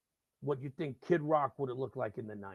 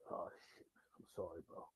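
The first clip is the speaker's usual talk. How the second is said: low, very soft undertone; sheepish apology; 9 semitones lower, 16 dB quieter than usual. Retold or imitated. Singer apologising after hearing the story, hushed and sheepish. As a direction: drop to an embarrassed near-whisper.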